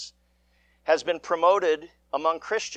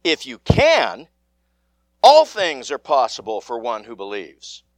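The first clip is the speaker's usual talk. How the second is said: emphatic Jesus; 5 semitones lower, 7 dB louder than usual. Acted. Jesus answering the father, louder and firm. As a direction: stress the words of Jesus.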